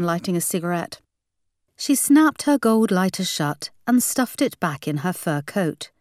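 ordinary delivery

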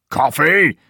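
high, loud, incredulous roar; enraged shout. A narrator's reading. Old man outraged at talk of coffee, enraged shout. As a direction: explode on the word. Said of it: "shouts the old man in a rage".

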